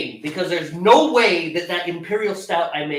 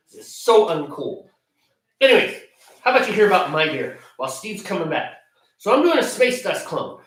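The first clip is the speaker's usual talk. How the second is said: ordinary talk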